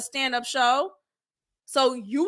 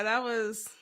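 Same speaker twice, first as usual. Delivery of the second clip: ordinary delivery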